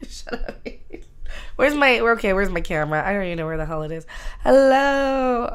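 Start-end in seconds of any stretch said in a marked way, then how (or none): 0.1-0.8 whispered, voiceless rebuke; whispering. Acted. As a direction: hush yourself in a whisper.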